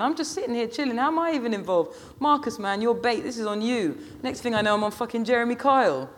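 put-on high-pitched, incredulous teenage girl; protesting girl. A narrator's reading. Girl blaming Marcus for dragging her in, incredulous. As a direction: complain high, blaming your mate.

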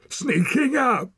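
loud, high, drawn-out snarl. A narrator's reading. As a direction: snarl it as a menacing accusation. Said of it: "he snarled".

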